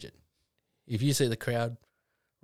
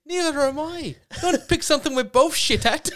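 high, loud comic retort; mock protest, pitched way up; Danny Boy; 8 dB louder than usual. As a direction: imitate the comedian's indignant punchline. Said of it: "He's like".

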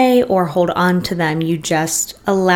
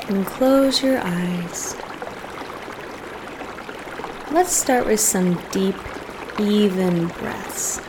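soft, unhurried meditation voice with long pauses; guided meditation; 7 dB quieter than usual. Real, not acted. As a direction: gently guide listeners into settling and breathing.

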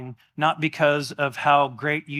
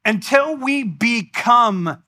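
higher, slow and emphatic; building preaching; 5 semitones higher, slower than usual. Real, not acted. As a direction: preach it home with rising force.

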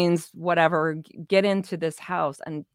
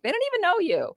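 much higher, incredulous comeback; indignant retort; 13 semitones higher, faster than usual. Retold or imitated. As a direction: fire it back in disbelief. Retold retort to ex-husband.

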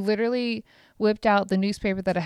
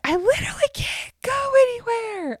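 very high, frantic protest; freaking out; 3 dB louder than usual. Retold or imitated. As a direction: squeal it at the car in exasperation. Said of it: "I'm freaking out".

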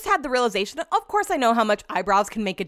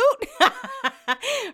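giggle; 12 semitones higher than usual. Real, not acted. Laughing at her own vanity.

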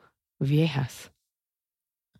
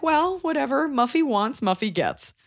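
a spoofed southern drawl, higher on Muffy wants; southern accent; 8 semitones higher, slower than usual. Retold or imitated. Mimicking indulgent parents of a bratty sister, mock southern accent. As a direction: play the doting southern parent. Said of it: "I gave it a southern accent".

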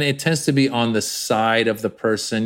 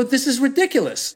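much higher, outraged disbelief; incredulous retort; 12 semitones higher than usual. Retold or imitated. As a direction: scoff it like it's absurd. Retelling his own objection.

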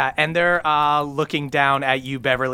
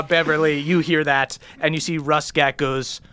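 ordinary talk, nothing marked

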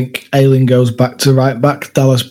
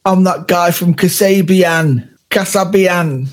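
Leicester accent; 5 semitones higher, slower than usual. Shown as a demonstration. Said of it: "Go on then".

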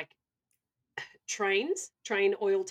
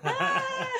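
excited squeal; 4 dB louder than usual. Real, not acted. Excited sign-off shriek.

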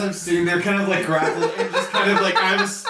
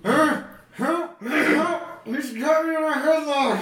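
high yell through a covered mouth; muffled yell; 12 semitones higher, much slower than usual. Acted. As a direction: cry for help through a headlock. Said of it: "muffled yell".

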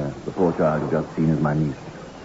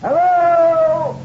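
calling out; slower than usual. Acted. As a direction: shout up to the watcher, far and high. Calling out to someone ashore.

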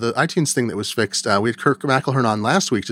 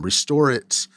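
ordinary talk, nothing marked

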